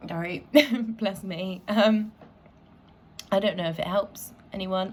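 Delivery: usual speaking voice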